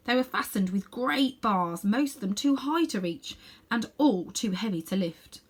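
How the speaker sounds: ordinary delivery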